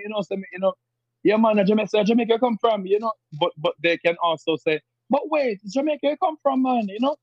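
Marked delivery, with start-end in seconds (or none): 1.2-2.9 Jamaican patois, pitched higher; older Jamaican patois. Retold or imitated. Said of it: "sound like, you know, say me". 5.1-6.9 Jamaican patois with a British touch, pitched much higher; British-tinged patois. Retold or imitated. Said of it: "a little British thing to it".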